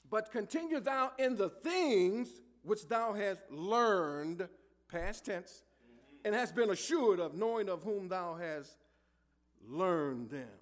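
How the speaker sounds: scripture reading. Acted. Preacher reading Paul's words to Timothy, slow and solemn. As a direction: lean on every word of the verse.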